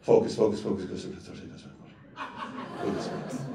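focus repeated, fading down to an unvoiced hush; self-coaching murmur. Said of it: "coaching himself to focus".

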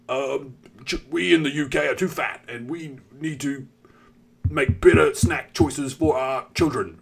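hammed-up jolly British official; mock British accent. Health advisers warning the nation, mock British accent. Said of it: "The British accent".

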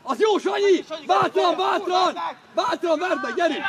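high, loud cheering on; encouraging shout. Real, not acted. Coach tells Sanyi to take his man on, encouraging shout. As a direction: yell to spur the player forward.

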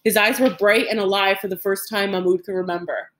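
ordinary delivery, nothing marked

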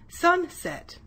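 "sunset" in two beats, high then falling; syllable demonstration. Shown as a demonstration. Teacher has pupils feel the mouth open, "sunset" in two beats. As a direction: split "sunset" into sun, set. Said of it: "when your mouth opens and closes".

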